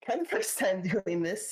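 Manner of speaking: first-timer nerves, laughing it off; nervous. Real, not acted. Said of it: "sorry if I sound a bit nervous".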